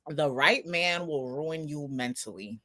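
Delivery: low, hushed, deadpan; low, quiet aside. Retold or imitated. Scene: host musing to listeners, low hushed deadpan. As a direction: say it softly under your breath, like a grim truth. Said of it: "I was just like".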